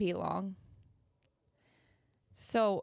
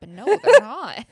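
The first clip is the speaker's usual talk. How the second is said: cracking up on the punchline, louder; laughing; 2 semitones higher, 16 dB louder than usual. Real, not acted. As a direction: burst out laughing at the end.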